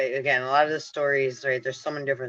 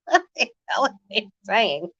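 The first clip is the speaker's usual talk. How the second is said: real giggle through the words, higher; laughing; 7 semitones higher than usual. Real, not acted. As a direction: laugh while asking, amused. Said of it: "They're making me laugh".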